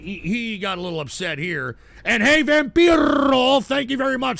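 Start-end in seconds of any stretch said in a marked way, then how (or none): 2.1-4.4 loud shout-out. Real, not acted. Greeting a donor by shouting his name across the stream. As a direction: call his name out big.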